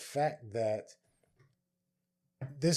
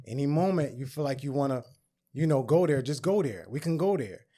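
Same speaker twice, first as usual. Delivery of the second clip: louder, goading dare; punchy mock challenge; retold challenge; 4 semitones higher, 6 dB louder than usual. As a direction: act out egging someone on to fight.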